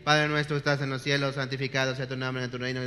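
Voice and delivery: rote rattled-off prayer. Shown as a demonstration. Preacher mocks praying by rote, fast and mindless. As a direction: rattle it off like empty repetition. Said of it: "simplemente repites por repetir".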